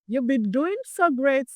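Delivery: normal talking voice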